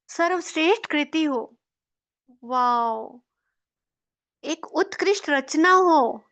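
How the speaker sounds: slow, loving praise spoken to your own reflection; tender self-affirmation. Shown as a demonstration. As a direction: admire yourself in the mirror, full of gratitude. Mirror self-affirmations demo. (आईने में खुद से प्यार भरी तारीफ़, कोमल आवाज़ में)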